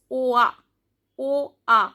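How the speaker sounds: "O A" glided like "wa"; "O A" modelled distinctly for learners; joint vowel sound. Shown as a demonstration. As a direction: say "O A" as a careful vowel demo. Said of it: "the pronunciation of O I".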